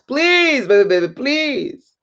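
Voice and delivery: hammed-up begging, pitched high; mock pleading. Seller begging a buyer, hammed-up pleading. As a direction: beg like a desperate seller, exaggerated.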